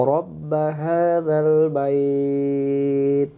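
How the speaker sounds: slow, drawn-out melodic recitation; Quran recitation. Shown as a demonstration. Teacher reciting the verse to students, slow melodic chant. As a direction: chant each syllable long and tuneful. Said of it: "teacher recites verse slowly".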